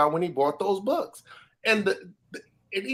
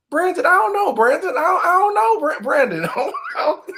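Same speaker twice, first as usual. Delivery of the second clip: high, loud, giggling through the words; laughing, tripping over the name; laughing stammer; 7 dB louder than usual. Real, not acted. To cohost Brandon, laughing and stumbling high and loud.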